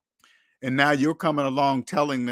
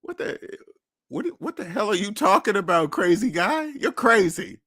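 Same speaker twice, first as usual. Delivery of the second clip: incredulous mock reaction; 6 semitones higher than usual. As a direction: act like you've just heard nonsense. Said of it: "look at me like an alien".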